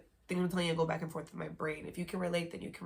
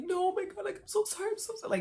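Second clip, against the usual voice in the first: very high, louder mock whimpering plea; imitated begging; 4 dB louder, faster than usual. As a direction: plead like him, high and frantic. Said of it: "like, begging me".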